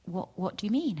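whispered, stunned question; shocked whisper. Retold or imitated. Couple stunned by the pastor's news, hushed whisper. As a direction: breathe it out in disbelief.

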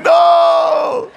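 high, wailing no; exasperated runner's cry; protesting shout. Acted. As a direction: shout it in mock agony. Runner protests at false praise.

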